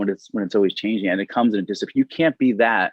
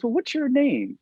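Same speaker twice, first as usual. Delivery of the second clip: curious high question; slower than usual. Retold or imitated. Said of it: "She goes".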